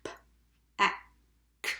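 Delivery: "p, a, k" as three isolated letter sounds; sounded out. Shown as a demonstration. Teacher tapping pack with pupils, sounded out. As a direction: tap out "pack" with gaps between sounds. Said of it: "tap pack".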